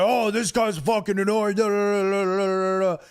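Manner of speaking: mocking imitation. Imitating the fan griping about Craw, mocking babble. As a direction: reduce his griping to singsong babble.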